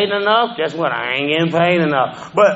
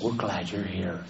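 tender; 12 semitones lower, 14 dB quieter than usual. Retold or imitated. Kind old lady welcoming him.

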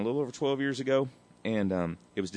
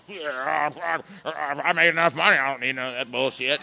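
put-on scoffing voice, getting louder; mock dismissive addict; 3 semitones higher, 5 dB louder than usual. Addict waves away prayer and meetings, in a mocking put-on voice. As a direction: imitate someone brushing off help.

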